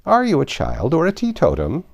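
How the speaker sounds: lightly voiced old sheep; old sheep shopkeeper. Old sheep asking Alice, lightly voiced. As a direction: ask like a shopkeeper.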